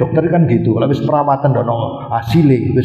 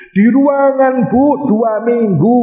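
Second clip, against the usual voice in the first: emphatic high telling; 9 semitones higher, slower than usual. Real, not acted. Dramatic recollection of hospital.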